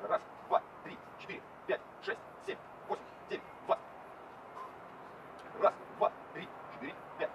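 whispering numbers under breath; whispered counting. Real, not acted. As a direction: count reps in a hushed whisper.

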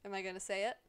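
usual speaking voice

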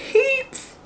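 high squeaky crack on "heaps". Real, not acted. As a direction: voice breaks up high. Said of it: "losing my voice".

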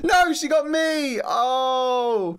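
high, loud, slow shout of dismay; excited shout. Real, not acted. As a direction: yell it as you get caught. Caught by the goose.

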